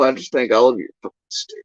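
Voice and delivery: ordinary talk, nothing marked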